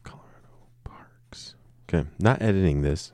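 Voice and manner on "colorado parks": very faint, voiceless whisper; barely audible whisper, thinking aloud; whispering to himself. Real, not acted. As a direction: whisper it under your breath. Muttering to himself after losing track.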